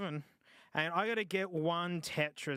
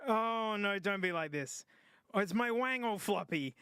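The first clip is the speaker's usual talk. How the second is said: ordinary talk, nothing marked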